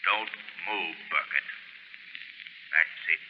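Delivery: low, hushed, commanding the mind; soft and steady, putting him under; hypnotic command. Acted. The Shadow hypnotizing Burkett, hushed and commanding.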